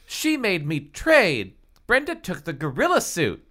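high, exasperated wail. Acted. Halle protests being made to trade, wailing. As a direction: burst out, then keep complaining high. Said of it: "Halle wailed".

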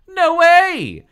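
very high, loud scream. Acted. Halle denies forcing the switch, screamed. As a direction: shriek a flat-out denial. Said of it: "Halle screamed".

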